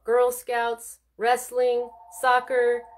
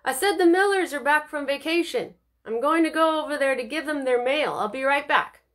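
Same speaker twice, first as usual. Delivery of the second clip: raised voice carrying down a hall; called out; 5 semitones higher, 3 dB louder than usual. Acted. Mother calls across the house, raised and higher. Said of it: "her mother called out from the hall".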